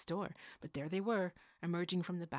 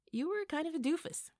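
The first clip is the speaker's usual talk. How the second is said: sister's teasing voice; 12 semitones higher, 7 dB louder than usual. Acted. Twin sister teasing brother.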